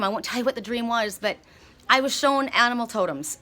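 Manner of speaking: ordinary talk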